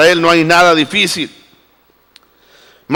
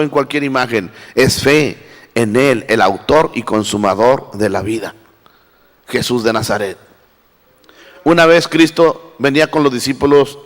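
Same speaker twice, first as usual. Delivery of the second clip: softer, lower, slowed preaching voice; quiet tender sermon; 6 semitones lower, 3 dB quieter than usual. Acted. Preacher to congregation, reverent, in a hushed, lower voice. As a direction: lean in and preach gently, almost confiding.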